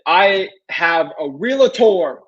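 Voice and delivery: slow imitation, realtor stretched and falling; mock the caller's smug "I have a realtor", stretching realtor; drawn-out realtor. Imitating a homeowner's slow, stressed "I have a realtor". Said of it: "They say it just like that".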